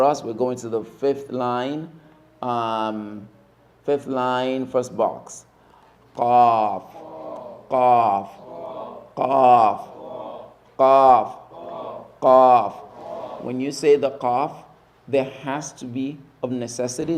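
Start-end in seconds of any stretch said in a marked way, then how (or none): 6.1-12.7 Qaf, crisp and loud, with a click at the back of the throat. Shown as a demonstration. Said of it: "there has to be a click in the back of the throat".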